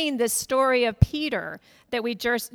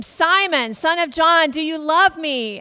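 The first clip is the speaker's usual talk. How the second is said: insistent Jesus; 5 semitones higher, 8 dB louder than usual. Retold or imitated. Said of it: "Jesus says to him".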